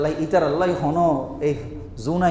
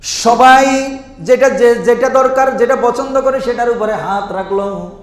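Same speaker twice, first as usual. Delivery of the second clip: loud, high, very slow emphasis; loud preaching; 9 semitones higher, 11 dB louder than usual. Acted. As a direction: stretch each word and boom it out. Preacher hammers home the story.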